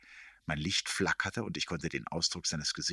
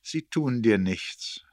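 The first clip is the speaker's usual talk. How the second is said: slow, higher, louder assurance; magnanimous; 5 semitones higher, 6 dB louder, slower than usual. A narrator's reading. Erik leading the tired narrator out, magnanimously reassuring. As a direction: reassure grandly, taking your time. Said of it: "versicherte er großmütig".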